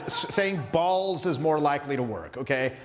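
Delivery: usual speaking voice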